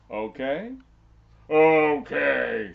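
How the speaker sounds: very loud, drawn-out okay; giant agreeing. Acted. Giant accepting the contest, very loud. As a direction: boom the agreement. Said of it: "said the giant".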